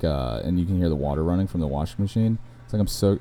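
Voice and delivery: ordinary delivery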